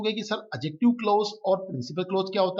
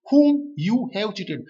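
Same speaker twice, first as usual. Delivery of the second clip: very slow and a bit louder, stretching "whom"; slow dictation reading; 3 semitones higher, 6 dB louder, much slower than usual. Shown as a demonstration. Teacher stressing the whom clause for students. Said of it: "teacher reads the joined clause".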